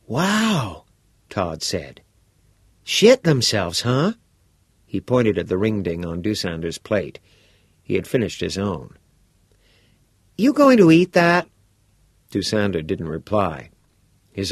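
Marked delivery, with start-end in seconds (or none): 0.1-0.9 put-on bright, curious teenage boy; a keen schoolboy pressing for details; eager boy voice. Todd reacting. 2.8-4.1 put-on bright, curious teenage boy; a keen schoolboy pressing for details; eager boy voice. Todd reacting. 10.4-11.5 put-on bright, curious teenage boy; a keen schoolboy pressing for details; eager boy voice. Todd asking.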